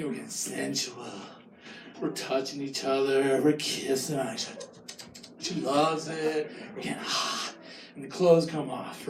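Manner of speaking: low, soft seductive storytelling; hammed-up seductive hush; mock sultry narration. Telling sensual scene low.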